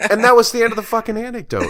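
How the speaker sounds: exasperated outburst. Retold or imitated. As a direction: blurt it out in disbelief at the pointless ending. Punchline, exasperated at pointless story.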